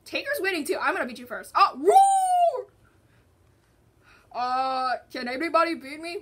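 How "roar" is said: high, loud, drawn-out pretend roar; tiger roar. Player voicing Tiger attacking first, high loud roar.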